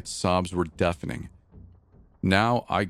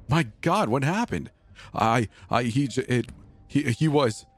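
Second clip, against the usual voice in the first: higher, broken, stammering terror; panicked sobbing; 7 semitones higher than usual. Acted. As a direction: gasp the words out between sobs. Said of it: "in between sobs".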